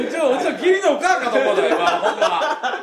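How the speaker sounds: loud, laughing Tosa-dialect outburst; laughing disbelief. Real, not acted. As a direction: blurt it out through laughter. Joking outburst amid laughter.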